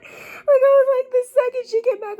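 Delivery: high, wailing half-laugh; a high wail of disgusted laughter; laughing cry. Real, not acted. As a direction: laugh through mock despair.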